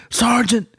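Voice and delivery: high, whiny wail through laughter; mock high-pitched complaint; upset Miku. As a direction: play the robbed reader, distraught.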